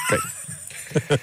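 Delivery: squeaky falsetto, slow; a high, drawn-out sarcastic okay; mock cheery. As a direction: chirp it in a silly falsetto to move on. Mock-cheery reset after joke.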